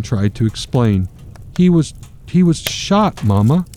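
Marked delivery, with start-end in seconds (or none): 1.6-3.6 a lightly acted sob in the voice; crying child. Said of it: "Michael, crying".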